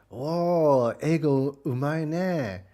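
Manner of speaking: high, loud drawn-out awe; hammed-up wowed reaction; mock amazement. Retold amazed reaction in Japanese.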